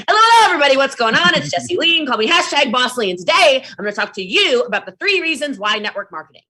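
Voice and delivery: big, high, loud hype opener; high energy intro. Shown as a demonstration. Demoing her live intro with big hype. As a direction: launch the live like a hyped host. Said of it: "let's just do my intro".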